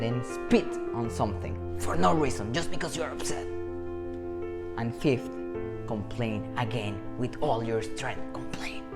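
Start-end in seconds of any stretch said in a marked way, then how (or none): none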